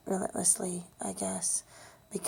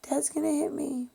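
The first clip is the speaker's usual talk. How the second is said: higher and louder retold cry for help; a frightened girl shouting for her mother down the hall; child yelling for mom; 7 semitones higher, 4 dB louder, much slower than usual. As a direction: call out to mom like a scared child again. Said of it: "I yelled at the top of my lungs".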